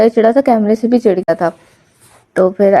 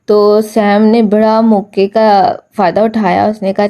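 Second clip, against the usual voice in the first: ordinary talk, nothing marked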